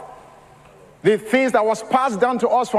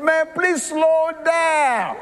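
drawn-out, very slow plea; slow pleading; 6 semitones higher, much slower than usual. Retold or imitated. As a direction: stretch every word, begging him to slow. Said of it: "I'm telling him".

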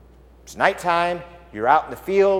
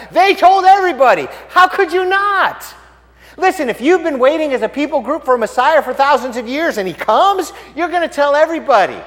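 high, loud, emphatic exclaiming; fervent preaching; 9 semitones higher, 5 dB louder than usual. Real, not acted. Preacher to congregation on telling the long-awaited news, fervent. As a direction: press the point with rising excitement.

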